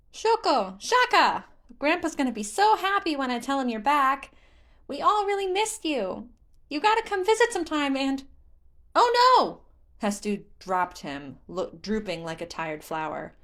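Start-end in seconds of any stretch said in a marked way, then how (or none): 0.1-8.2 high, loud, bouncy little forest spirit; excited Korok voice. Acted. Korok Hestu greets Link gleefully. 9.0-9.6 very high, loud cry of alarm; a child realizing something is lost; sudden dismay. Acted. Hestu suddenly remembers.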